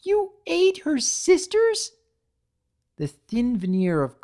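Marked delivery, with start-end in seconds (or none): 0.0-1.9 very high and loud, aghast; horrified outcry. Acted. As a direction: cry out in disbelief. Fluttershy horrified at Pinkie.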